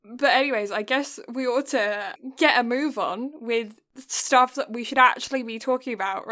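ordinary delivery

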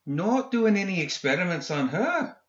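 high, sarcastic question. A narrator's reading. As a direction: needle him sarcastically. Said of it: "McMorning asked sarcastically".

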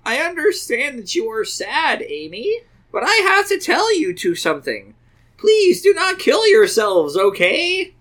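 high, pleading mock-tearful mom; very sad, meaning it. A narrator's reading. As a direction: plead earnestly, hammed-up sorrow. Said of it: "in a very sad and meaning it way".

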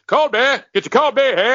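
loud, high stadium vendor call; Wally the Beer Man. Retold or imitated. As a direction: bellow it out like a ballpark beer hawker. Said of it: "Wally the Beer Man from those Miller Lite commercials".

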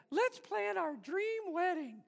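slow, high, sweet doll voice; dreamy talking-doll chirp, drawn out; Barbie voice box. Retold or imitated. Pastor mimicking Barbie's voice box, slow and high. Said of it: "she would say".

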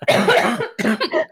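word stretched out by laughter; laughing through the end. Real, not acted. Still laughing mid-sentence.

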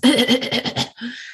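acted, sputtering cough; coughing up water. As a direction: hack the river water out of her lungs. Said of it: "starts coughing up".

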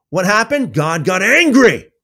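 normal talking voice

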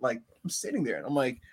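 ordinary talk, nothing marked

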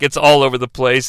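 ordinary delivery

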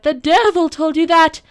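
very loud, high enraged shout; furious cry. A narrator's reading. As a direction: explode with rage at full pitch. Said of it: "cried the little man angrily".

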